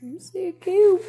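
cute mouse voice. Pretend. Mouse character speaks.

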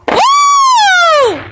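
loud high whoop; high cheer. Real, not acted. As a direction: whoop with joy. Cheering at firework.

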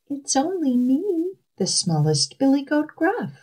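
high little billy goat voice; tiny high-voiced kid goat. A narrator's reading. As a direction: pitch it up like a small, meek goat. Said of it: "answered in his tiny voice".

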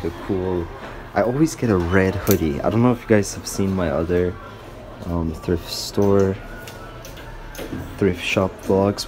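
usual speaking voice

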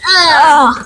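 loud mock yelp; pretend scream. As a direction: cry out as if bitten. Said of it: "pretend they bite".

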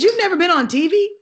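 high, loud disbelieving question; mock-astonished neighbor; surprised neighbor. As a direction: ask it in total disbelief. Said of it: "He said".

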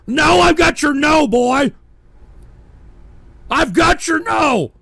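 high, loud defiant shout; outraged bellowing; angry retort. Real, not acted. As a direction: fling it back, yelling. Retorting angrily to a troll.

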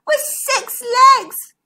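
high, loud, drawn-out wail; weeping. A narrator's reading. Zara describes the monster to her cousin, weeping. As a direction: draw it out in a tearful squeal. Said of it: "wept Zara".

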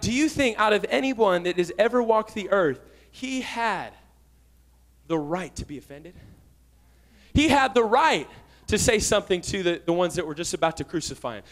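ordinary delivery

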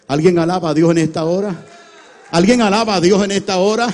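very loud call for praise; full-force preaching. Real, not acted. Preacher calling the congregation to praise God, loud shout. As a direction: belt out a rousing call to the church.

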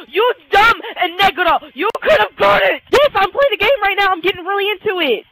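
high, loud exasperation; yell, totally caught up in the game; real heated outburst; worked-up yelling. Real, not acted. Yelling at teammate, getting into it.

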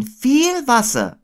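put-on high, drawn-out boy voice; Christopher Robin. A narrator's reading. Boy agreeing with Owl, high and drawn out. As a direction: echo it high and stretched. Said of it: "sagte Christopher Robin".

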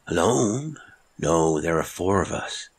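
a hint of a strange old sailor, measured; old marooned seaman, distant and odd; lightly voiced old castaway. Heywood answers.

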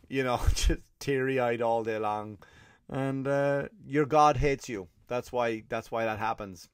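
ordinary talk, nothing marked

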